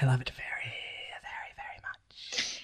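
very soft, unvoiced relish, slowing down; creepy whisper. Creepy character confiding pleasure aside.

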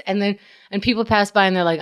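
normal talking voice

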